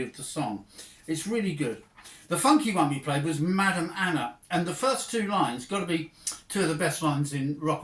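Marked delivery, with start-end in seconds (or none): none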